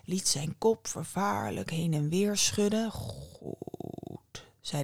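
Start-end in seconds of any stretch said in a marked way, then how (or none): 2.9-4.4 very soft, deep drawn-out growled word; low bear growl. A narrator's reading. Bear reluctantly granting the young woman's plea, low growl. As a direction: rumble it quietly like a bear. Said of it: "De beer gromde zachtjes".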